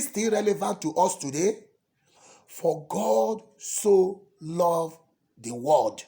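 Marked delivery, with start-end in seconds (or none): 2.5-6.0 slow intoned verse. Acted.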